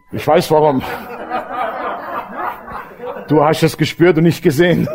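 amused retort breaking into laughter; laughing as you let them in on it; knowing laughter. Retold or imitated. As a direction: say it through a laugh. Joking knowing reply, laugh heard.

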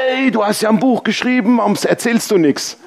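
high-pitched mock reproach; mock indignant surprise. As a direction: imitate a friend's astonished complaint, pitched high. Spielt Nachricht des Bekannten nach. (gespielt vorwurfsvolles Staunen, hoch)

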